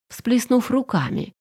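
ordinary delivery, nothing marked

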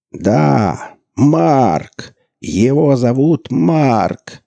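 high, loud slow announcer shout; shouting commentator. A narrator's reading. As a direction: yell the name to the arena. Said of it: "кричал комментатор".

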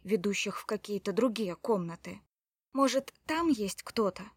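ordinary delivery, nothing marked

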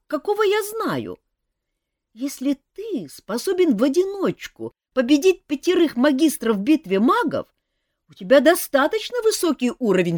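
put-on young elf prince. A narrator's reading. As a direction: confess it seriously but eagerly. Принц упрашивает Жака. (молодой принц-эльф, серьёзно, выше и громче)

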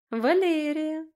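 high, loud, lilting self-introduction; sing-song flirtation. A narrator's reading. Friend introduces herself to Roman, cooing sing-song. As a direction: sing out your name flirtatiously. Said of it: "пропела та".

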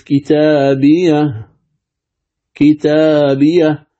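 كتابية recited slowly, stopping on a silent ha; وقف على هاء السكت; pausal stop. Shown as a demonstration. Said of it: "يعني كأنني ساقف،".

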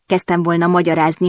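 ordinary delivery, nothing marked

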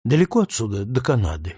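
usual speaking voice

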